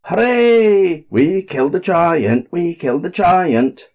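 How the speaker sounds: high, loud cheer of triumph. A narrator's reading. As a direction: shout it out in victory. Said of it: "they all shouted".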